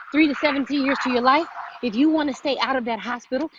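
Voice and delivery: normal talking voice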